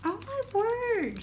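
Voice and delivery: high delighted oh my word; bright amazed gasp of words; amazed exclamation. Real, not acted. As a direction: let the wonder spill out high. Opening a gift package.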